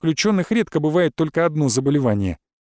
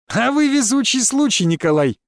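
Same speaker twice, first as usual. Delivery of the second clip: gleeful, pitched up high; amused; 7 semitones higher than usual. A narrator's reading. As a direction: tease him with delight. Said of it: "Кустов не прекращал веселиться".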